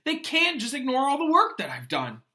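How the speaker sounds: high, loud anguished howl; a loud, high cry of outrage. Acted. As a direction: wail it in protest. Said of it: "Ron howled".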